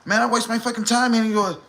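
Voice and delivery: high, fast, exasperated mock complaint; Scar getting mad. Scar complaining about fetching the drink, high fast mock exasperation. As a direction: rattle it off high like he's put out. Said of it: "And he get mad like".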